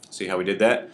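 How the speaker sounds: normal talking voice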